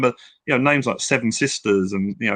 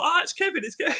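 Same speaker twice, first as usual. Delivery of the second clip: mock surprise; 6 dB quieter, faster than usual. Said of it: "I went".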